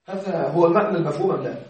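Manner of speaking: usual speaking voice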